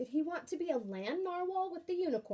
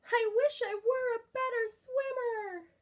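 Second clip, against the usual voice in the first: Kelp wishing; 9 semitones higher than usual. Acted. Kelp wishes aloud.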